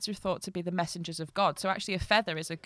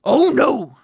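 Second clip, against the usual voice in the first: loud, raised story-character cry; miller's protest; 6 semitones higher, 13 dB louder than usual. A narrator's reading. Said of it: "said the miller".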